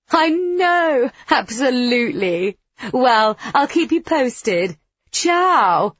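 high, loud phone gush. A narrator's reading. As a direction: sign off with showy enthusiasm. Gushing smug phone sign-off, after laughter.

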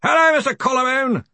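high, hearty yell; Ridcully. Acted. Arch-Chancellor greeting a far-off researcher, yelling. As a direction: yell a greeting over distance. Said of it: "yelled Ridcully".

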